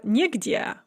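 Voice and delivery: нигде said as nie-gde, stressed nie; stress on first syllable. Shown as a demonstration. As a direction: hit nie at the start of нигде. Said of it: "the stress is on the nie".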